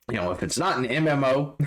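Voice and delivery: chuckling through the words; laughing. Real, not acted. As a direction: laugh as you say it. Laughing at own MMO point.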